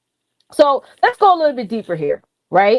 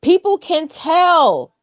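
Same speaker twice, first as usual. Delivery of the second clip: raised pitch with a strong push on "tell"; excited emphasis; 7 semitones higher, much slower than usual. Real, not acted. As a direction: drive the point home with excitement. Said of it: "I'm just excited".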